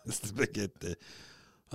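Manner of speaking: spaghetti with rolled Italian vowels, slow; Italian accent. Shown as a demonstration. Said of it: "say it in an Italian accent".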